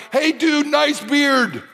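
high, bright call out to a stranger; retold yell across yard. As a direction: pastor retells hailing a bearded student. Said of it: "I literally yell out across the yard".

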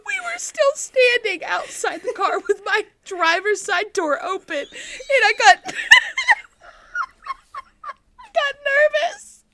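high voice breaking with real laughter; helplessly cracking up mid-story; laughing at herself. Real, not acted. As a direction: struggle to get the story out between giggles. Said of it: "I'm still laughing at myself".

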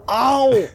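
sharp high ouch; high pained yelp. Acted. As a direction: yelp in pain. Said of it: "slaps him again".

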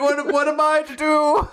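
high, mock-helpless wail; fainting father. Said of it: "their father is, like, fainting".

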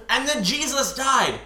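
hammed-up dramatic declaration; mock passion play. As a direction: proclaim it like an overdone stage play. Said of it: "her adaptation of, like, a passion play".

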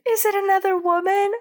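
put-on high-pitched dramatic girl voice; mock jealous girl. Little girl questions her departing crush, mock jealous. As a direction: ask it high, as a hammed-up jilted sweetheart.

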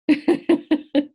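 high, loud laugh at the punchline; loud burst of laughter. Real, not acted. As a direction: break into real laughter.

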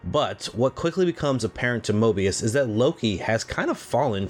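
steady scripted recap read; recap narration. Acted. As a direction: read the plot summary like a host. Podcast host reads episode recap.

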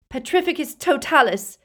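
yelled spell, high and loud; forceful, high battle cry; Harry. A narrator's reading. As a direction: shout the incantation in panic. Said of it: "yelled Harry".

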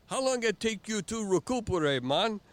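high, loud teasing island voice; Jamaican head guy. Retold or imitated. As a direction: play the head instructor ribbing him. Head guy asks after race.

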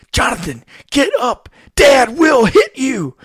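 high, loud warning yell at his brother; kid's warning shout. Retold or imitated. Older son yelling at little brother to get up. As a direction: shout it like a panicked big brother. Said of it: "he says".

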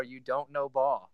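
slow, drawn-out put-on catchphrase. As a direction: drag out the diss like a signature line. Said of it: "He needs to bring back".

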